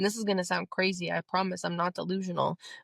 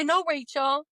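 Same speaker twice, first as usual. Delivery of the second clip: higher and a bit louder, NO pushed up hard; firm no; 7 semitones higher, 4 dB louder, slower than usual. Shown as a demonstration. Telling the inner critic off, firm and raised. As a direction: put your foot down on No.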